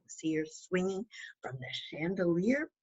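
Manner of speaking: put-on whispered aside to a child. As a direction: point at the picture and whisper. Pointing at the picture for a child.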